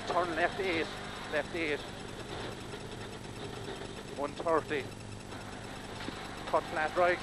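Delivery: normal talking voice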